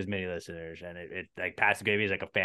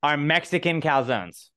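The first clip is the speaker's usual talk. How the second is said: emphatic declaration; 7 semitones higher, 7 dB louder than usual. Real, not acted. Emphatic loud claim.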